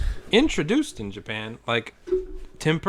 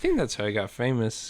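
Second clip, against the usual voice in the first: usual speaking voice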